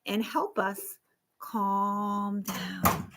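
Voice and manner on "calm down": low, soft and slow, settling down; calming voice. Shown as a demonstration. Counselor showing kids how calm sounds, softly. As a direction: ease the words down like a deep breath.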